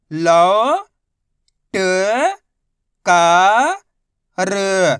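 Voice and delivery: each letter held long and loud, a pause after each; syllable by syllable. Shown as a demonstration. Teacher showing learners each letter's sound, syllable by syllable. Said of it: "एक अक्षर का उच्चारण किया जाता है".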